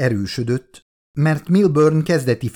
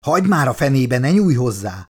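plain reading voice

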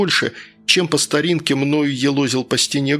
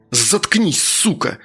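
angry outburst; 3 semitones higher, slower than usual. A narrator's reading. Narrator tells the chattering worker to shut up, angry outburst. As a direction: spit it out with contained fury. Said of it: "со злостью выкрикнул я".

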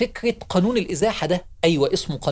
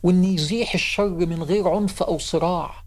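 ordinary delivery

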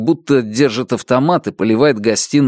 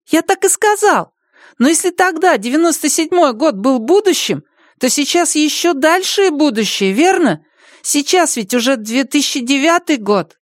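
put-on child voice. Boy continues argument.

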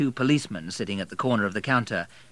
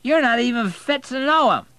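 put-on very high, loud drunken outburst; drunken indignant cry; angry drunk; 9 dB louder than usual. A narrator's reading. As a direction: lash out angrily, slurring. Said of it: "said Matthew angrily".

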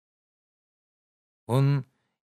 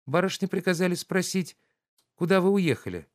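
higher, lively maid's voice; young maid; 9 semitones higher than usual. Acted. Katya the maid brings the young lady's question, brightly. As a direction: chirp it like an eager young maid.

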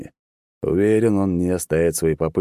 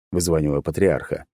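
plain reading voice

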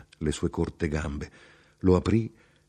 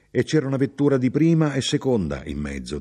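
raised, quick, eager explanation; higher, louder, hurried chatter; chatty, contented traveller, explaining; 7 semitones higher, 4 dB louder than usual. Acted. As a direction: explain yourself busily to a fellow passenger. Traveller continues his explanation.

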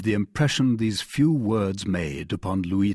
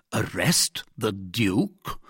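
slow, low, astonished question; measured incredulity, rising at the end; incredulous cardinal; 3 semitones lower, 3 dB quieter, slower than usual. Acted. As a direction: repeat the idea slowly in disbelief. Cardinal balks at king's demand.

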